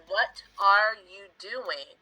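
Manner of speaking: every word said slowly; careful, unhurried full form. Shown as a demonstration. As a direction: spell out each word of the question. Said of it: "is a lot of work to say in your mouth".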